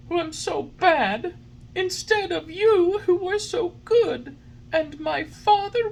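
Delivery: high, wailing puppet sobs; sobbing. A narrator's reading. Pinocchio mourns the dead fairy and his lost father, sobbing. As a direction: wail it in a very high voice, drawing words out. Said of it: "As he sobbed".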